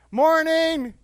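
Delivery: bright, high, loud "Morning!"; cheery greeting. Retold or imitated. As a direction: sing out a happy good morning. Angie greets cheerfully, changed woman.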